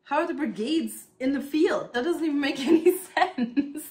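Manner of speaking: high, loud, baffled protest breaking into a laugh; can't believe the grown-up doesn't get it; frustrated child. Retold or imitated. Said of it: "And I was like".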